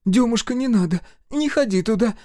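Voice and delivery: высокий, громкий отчаянный крик матери; закричи, удерживая сына; мать вскрикивает. A narrator's reading. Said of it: "вскрикнула мать". (высокий испуганный вскрик матери)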